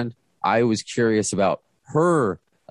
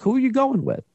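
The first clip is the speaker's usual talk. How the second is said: much higher, quizzical question; curious question. Retold or imitated. Host retells asking the guest who comes along, high and curious. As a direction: ask it high and curious, half teasing. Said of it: "I'm like".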